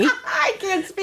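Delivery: high, drawn-out words through laughter; real helpless laughing. Real, not acted. Reader cracking up over her own stumble, laughing. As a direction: squeal it out while laughing.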